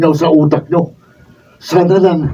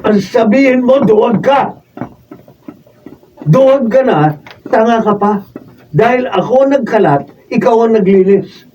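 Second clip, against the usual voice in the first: higher, jeering taunt at a coward; sneering, raised-pitch insults; mocking taunt; 6 semitones higher than usual. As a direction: rub his shame in his face.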